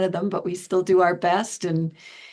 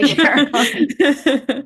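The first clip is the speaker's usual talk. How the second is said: drawn-out joking plea; 7 semitones higher, 7 dB louder, much slower than usual. Real, not acted. Joking about running out of time.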